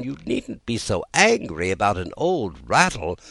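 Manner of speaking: usual speaking voice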